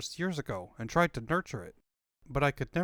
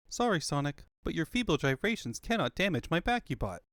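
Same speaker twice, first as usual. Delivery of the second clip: ordinary talk, nothing marked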